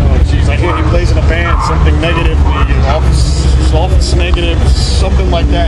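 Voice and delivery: shouting loudly over live music. Real, not acted. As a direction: yell it over a loud band.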